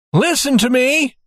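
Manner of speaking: high, straining call through the safe door. A narrator's reading. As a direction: call to the child as loudly as possible. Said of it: "he called as loudly as he could".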